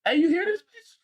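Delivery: incredulous high exclaim. Retold or imitated. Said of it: "and I was like".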